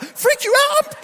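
high, incredulous shriek; panicked shout. Retold or imitated. Driver echoes worker's apology about spider, shrieked panic. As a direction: throw it back as a hysterical shout.

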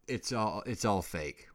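usual speaking voice

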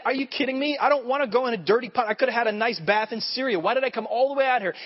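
high-pitched incredulous complaint, acted out; frustrated. Naaman complaining about being sent to a dirty river, acted frustration. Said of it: "he begins to leave frustrated".

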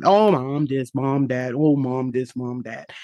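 low-pitched imitation of teenage griping; mock complaining. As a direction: grumble it lightly, like an old complaint. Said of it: "complain about my mom".

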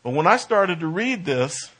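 ordinary delivery

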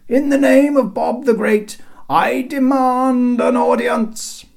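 high, loud proclamation with a drawn-out finish; hailing herald. A narrator's reading. Herald demanding an audience from the city, loud hail. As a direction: announce it grandly to the ramparts. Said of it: "hailed Cedric".

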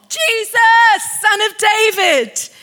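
high, insistent shout; urgent, high-pitched plea. Acted. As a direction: call out persistently for mercy. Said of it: "he cried out all the more".

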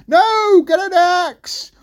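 high, loud yelled correction; exasperated shout. Acted. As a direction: yell it down to mum. Jack shouts the correction again.

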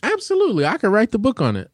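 brag, squeaky high and proud; high cocky boast. Real, not acted. Boasting about emotional intelligence.

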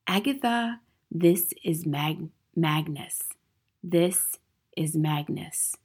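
slow, halting, hushed reading; ghostly written message. A narrator's reading. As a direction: spell it out slowly as it appears. Chalk writing read out as message.

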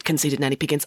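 plain reading voice

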